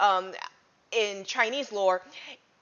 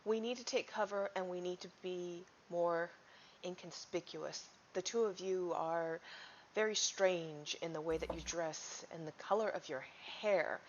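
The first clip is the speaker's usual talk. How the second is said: much softer, hushed warning; hushed urging; 11 dB quieter than usual. Acted. Warning the strangers to take cover, hushed.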